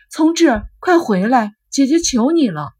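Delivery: loud, high pleading call; desperate calling. A narrator's reading. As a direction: beg him to come back with all her voice. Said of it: "不管优希怎么拼命的叫".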